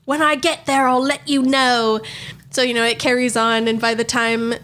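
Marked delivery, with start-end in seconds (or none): 0.1-2.0 drawn-out mock celebrity voice; slow parody of a man posing as a singer. Fake singer promises hosts an update, stretched parody voice. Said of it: "And she's like".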